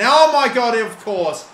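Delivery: high, loud cry at the woodwork; real agonised yell; anguished outcry. Real, not acted. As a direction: react as it clangs off the post. Shot hits the woodwork.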